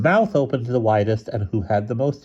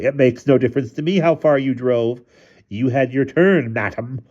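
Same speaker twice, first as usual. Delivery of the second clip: raised, higher-pitched scolding; very disagreeable; 6 semitones higher, 4 dB louder than usual. A narrator's reading. Husband refusing his wife the car, very disagreeable. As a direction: argue it disagreeably, slowing toward the end. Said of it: "said in a very disagreeable way".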